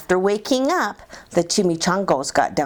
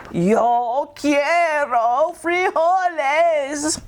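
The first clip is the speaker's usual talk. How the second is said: slow and grave, a higher put-on voice for the big declaration; somber declaration; 7 semitones higher, much slower than usual. A narrator's reading. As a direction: solemnly declare every word at a crawl. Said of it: "in his most somber voice".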